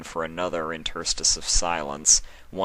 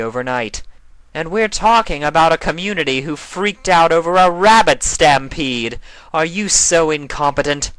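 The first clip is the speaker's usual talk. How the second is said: higher and louder, haughty alicorn queen mocking; proud Nightmare Moon; 8 semitones higher, 8 dB louder than usual. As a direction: looks down on her rival, boasting loudly. Nightmare Moon boasts, scornful.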